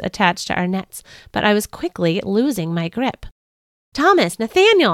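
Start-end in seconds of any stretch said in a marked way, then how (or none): 3.9-4.9 high, loud holler for help. Acted. Peter hollers to Thomas and Nathaniel for help. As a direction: yell across the boat for help. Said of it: "I hollered".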